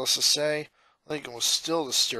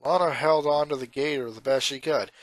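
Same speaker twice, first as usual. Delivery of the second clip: ordinary delivery, nothing marked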